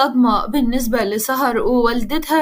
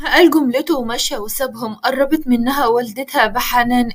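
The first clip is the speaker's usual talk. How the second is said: plain reading voice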